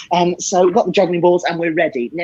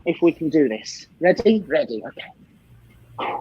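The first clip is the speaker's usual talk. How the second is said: normal talking voice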